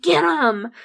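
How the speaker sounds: sharp loud order to the dog; loud command. Retold or imitated. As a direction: sic the dog on them. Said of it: "he's like".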